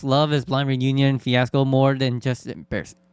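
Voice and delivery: usual speaking voice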